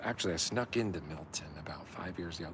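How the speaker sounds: whispered confession; whispered aside. Real, not acted. Confessing to viewers he snuck into Milton, whispering. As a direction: let viewers in on a secret.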